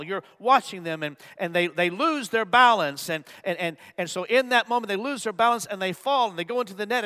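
ordinary delivery

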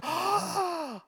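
high, imitated circus-crowd gasp; mock-shocked intake of breath; audible gasp. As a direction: gasp as the acrobat falls. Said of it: "everyone goes".

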